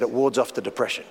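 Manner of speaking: hint of a gruff stranger, quiet and low; imitating a raspy man. Lonely photographer telling the pastor why, low and raspy. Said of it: "He had a very raspy voice".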